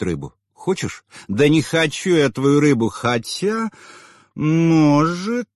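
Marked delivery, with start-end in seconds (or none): 1.3-5.5 tipsy dismissive then coy. A narrator's reading.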